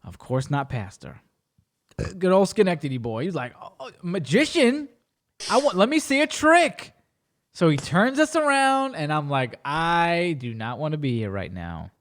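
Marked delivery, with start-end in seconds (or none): 3.6-6.9 higher-pitched eager request; excited pastor. Retold or imitated. Voices Pastor keen on magic. 9.6-11.9 low, slow, dragged-out dread; reluctant. Retold or imitated. His reluctant inner reaction.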